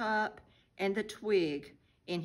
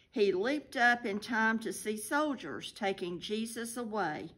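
usual speaking voice

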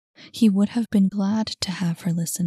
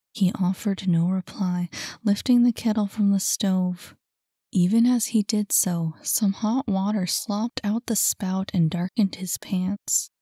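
usual speaking voice

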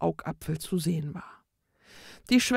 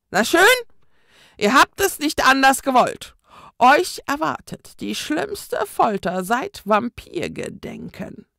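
threatening ghost; 6 semitones higher, 6 dB louder than usual. A narrator's reading. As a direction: promise the worst torture with relish. Ghost announces worst torture.

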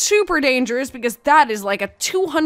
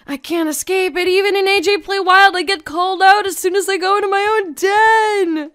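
high-pitched, worked-up wail of protest; exasperated complaint; 6 semitones higher, 4 dB louder than usual. Real, not acted. Player called out in their own den, exasperated complaint. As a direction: protest it, getting louder.

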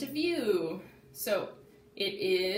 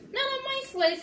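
friend voice; 5 dB louder than usual. Acted. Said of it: "said Max".

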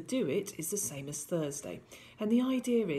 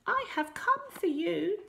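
squeaky strange little man; high squeaky voice; 12 semitones higher than usual. A narrator's reading. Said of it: "in a high squeaky voice".

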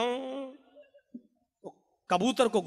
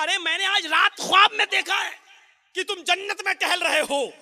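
a raised, eager telling of the dream; excited disciple; 8 semitones higher than usual. Acted. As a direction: burst out with the good news. Disciple excitedly tells his dream. (उत्साहित मुरीद की ऊंची आवाज़)

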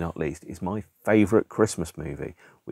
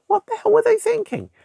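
much higher, louder disbelief; incredulous outburst; 5 dB louder than usual. Real, not acted. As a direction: throw up your hands in outraged disbelief. Outraged reaction to the closing line.

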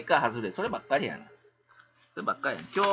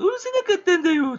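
much higher mocking falsetto, louder; teasing high-pitched mimicry; 14 semitones higher, 5 dB louder than usual. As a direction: parody the other streamer's whine. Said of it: "とか言うてんの？".

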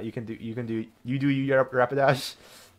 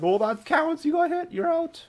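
high-pitched mock protest; dodgeball player's call; 3 dB louder, slower than usual. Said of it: "and you're like".

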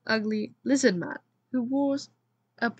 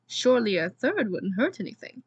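ordinary delivery, nothing marked

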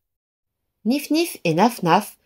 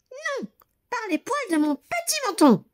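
much higher little pig voices; protesting pigs; 4 dB quieter than usual. A narrator's reading. The pigs refusing the wolf, high and protesting. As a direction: squeal the refusal back. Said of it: "protestèrent les cochons".

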